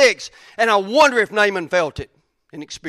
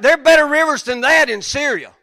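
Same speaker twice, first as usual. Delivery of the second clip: raised, louder indignant protest; retold anger; 7 semitones higher, 5 dB louder than usual. Naaman scorning the Jordan River, mock angry outburst. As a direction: act out an affronted, angry objection. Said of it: "He's pissed".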